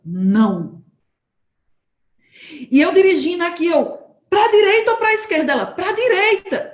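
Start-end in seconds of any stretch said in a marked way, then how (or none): 0.1-0.8 low, firm inner no; a low warning from within. Retold or imitated. Inner voice of the Spirit warning her. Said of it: "dentro de mim veio aquela impressão". 4.3-5.5 high, loud anxious asking; urgent question. Retold or imitated. Driver asking friend at the fork, anxious and loud. 5.7-6.7 friend answering. Retold or imitated.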